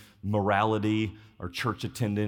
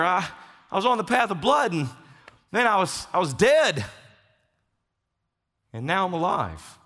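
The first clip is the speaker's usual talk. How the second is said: higher, louder, urgent confused questions; bewildered revived man; 10 semitones higher, 6 dB louder than usual. Acted. Revived man asks the innkeeper in bewildered, higher excited voice. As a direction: sound stunned to be breathing again.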